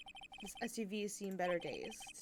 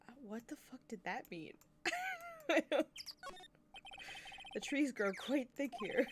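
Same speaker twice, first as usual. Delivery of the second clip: normal talking voice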